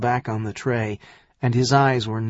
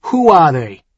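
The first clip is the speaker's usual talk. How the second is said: loud, higher, harsh question; harsh demand; 5 semitones higher, 8 dB louder than usual. Acted. Son-in-law presses for his accusers' names, harsh and loud. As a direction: press hard for an answer, loudly. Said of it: "Tyrion asked harshly".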